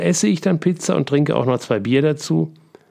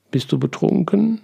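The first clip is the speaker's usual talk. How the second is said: surprised question; 7 semitones higher than usual. Acted. Daughter finds father laughing with wine.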